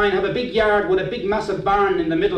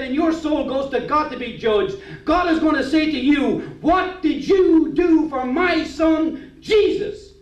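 raised pitch, slowing into the question; pastor warning of judgment; 6 semitones higher, slower than usual. Retold or imitated. Pastor warns a man about being judged, pressing preaching. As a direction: build to God's solemn, drawn-out question. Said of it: "he said".

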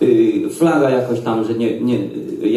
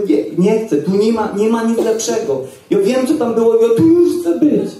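much higher, rising insistent plea; excited pleading; 10 semitones higher, 3 dB louder than usual. Retold or imitated. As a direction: refuse to go back, voice climbing ever higher. Arguing with Jesus to stay. (coraz wyższe, podekscytowane błaganie)

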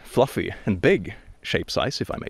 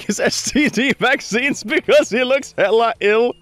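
laughing; 11 semitones higher than usual. Real, not acted. Laughing high.

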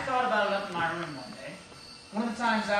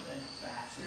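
"then faster" soft and low; hushed suspense; 5 semitones lower, 11 dB quieter than usual. Acted. [name] telling the dog's first quickening, hushed suspense. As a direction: hold "faster" back, low and quiet. Dog's approach building toward the meat.

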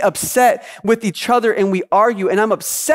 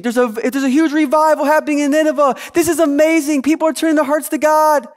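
higher, quick excited burst; excited posting; 7 semitones higher, faster than usual. Acted. People online sharing news of a huge revival, excited. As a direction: gush it like breaking revival news. Acting out social media posts about revival.

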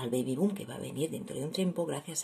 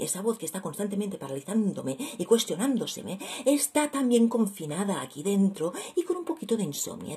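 ordinary talk

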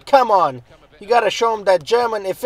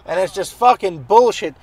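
ordinary delivery

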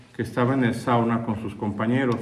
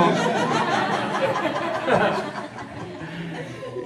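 big laugh. Real, not acted. Speaker laughs at own joke.

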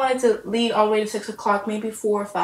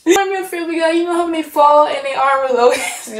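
laughing; 5 semitones higher, 4 dB louder than usual. Real, not acted. Laughing about cartoon.

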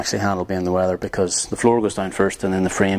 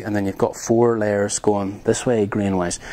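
ordinary talk

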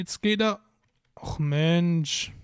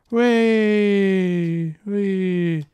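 excited whoop; 3 semitones higher, 4 dB louder than usual. Real, not acted. Whooping as avatar falls.

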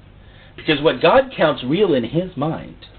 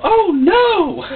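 mock alarmed demon; 5 dB louder than usual. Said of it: "And they go".

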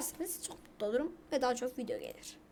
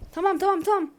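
quick, higher and louder okays; eager okay; 4 semitones higher, 7 dB louder, faster than usual. Real, not acted. Gamer urging the match to start, eager higher louder okays. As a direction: hurry it along, finally. Relieved as the game loads.

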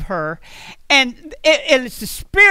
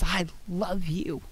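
tender; 6 semitones lower, 14 dB quieter, slower than usual. Retold or imitated. God's words voiced tenderly.

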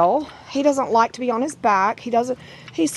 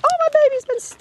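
very high panicky wail, re-enacted; mock freak-out; 4 dB louder, faster than usual. Breeder retelling finding spots of blood, high freak-out. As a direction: squeal it like an overwrought pet mom. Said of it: "I'm just freaking out".

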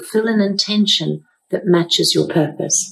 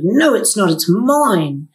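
mock-indignant child's comeback in Bergen accent; imitating granddaughter's indignant insistence; indignant retort; 3 semitones higher, slower than usual. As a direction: retort like an affronted little girl, leaning on MINE. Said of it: "she was very indignant".

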